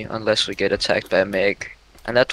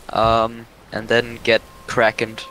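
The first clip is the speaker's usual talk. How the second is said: ordinary talk, nothing marked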